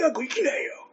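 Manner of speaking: 高く小さく絞った声の物真似; 老いた落語家を声色で真似る; 談志師匠. Acted. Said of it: "男子さんですか？". (弱々しい師匠の物真似)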